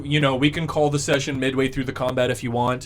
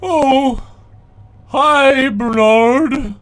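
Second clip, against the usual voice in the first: high, loud squeaky greeting; Bubblegum's voice; 11 semitones higher, 10 dB louder, much slower than usual. Acted. Walrus greeting the giant, high and loud. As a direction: chirp it up high like a walrus creature. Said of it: "Bubblegum goes".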